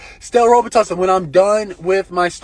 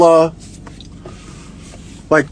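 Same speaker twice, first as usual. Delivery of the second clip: ordinary talk, nothing marked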